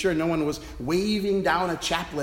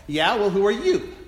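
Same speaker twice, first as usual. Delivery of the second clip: louder, higher indignant comeback; mock-defiant comeback; smart-alecky retort; 5 semitones higher, 5 dB louder than usual. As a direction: fire it back with cheeky indignation. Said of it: "I said smartly".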